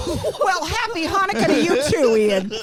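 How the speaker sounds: a hint of a cheery mom. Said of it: "His mom's like".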